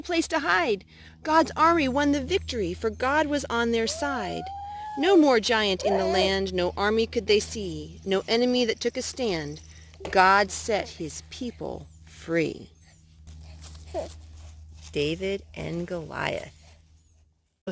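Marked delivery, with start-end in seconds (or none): none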